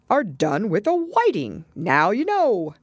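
ordinary delivery, nothing marked

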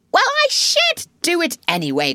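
high-pitched, defiant cry; excited outburst. A narrator's reading. Toad refuses his friends' orders, excitedly. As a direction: burst out in protest. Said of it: "cried Toad, getting excited".

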